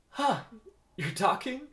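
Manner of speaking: high, loud startled question; startled disbelief. Acted. Vanessa hears a bee speak, startled. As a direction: react in shock, voice jumping up.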